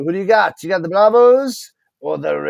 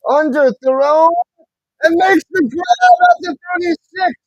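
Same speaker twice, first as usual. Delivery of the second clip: higher, louder surge as the pass is caught; excited play-by-play; 10 semitones higher, 6 dB louder than usual. Real, not acted. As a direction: shout the big catch to the viewers. Calls a big completed catch.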